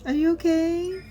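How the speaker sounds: alarmed and worried; shocked concern. Real, not acted. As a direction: gasp then ask if he is okay. Reacting to character's distress.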